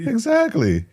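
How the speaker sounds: christmas elf. Retold or imitated. Keeps the elf voice going.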